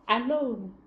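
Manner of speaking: ordinary delivery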